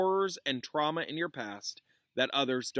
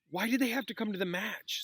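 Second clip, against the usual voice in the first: bitterly; 11 semitones higher than usual. A narrator's reading. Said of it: "said Harry bitterly".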